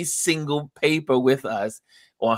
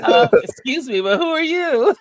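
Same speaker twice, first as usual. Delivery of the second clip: high, loud puzzled question; surprised client meeting her lawyer in person; confused greeting; 13 semitones higher, 7 dB louder than usual. Retold or imitated. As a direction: act out not recognizing him, voice way up. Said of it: "I said".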